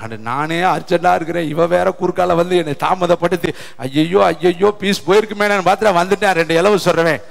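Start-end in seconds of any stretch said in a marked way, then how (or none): 0.0-5.5 low, quiet worried grumble; fretting pastor. Retold or imitated. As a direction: mutter anxiously about the delay. Pastor grumbles about being delayed.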